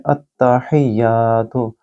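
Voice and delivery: अत्तहियातु slowly with a doubled त; slow tajweed. Shown as a demonstration. Teacher showing the correct word, slow recitation. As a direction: stretch अत्तहियातु, holding the long या. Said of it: "इसको इस तरीके से पढ़िए".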